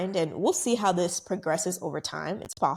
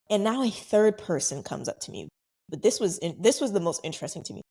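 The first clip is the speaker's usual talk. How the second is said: usual speaking voice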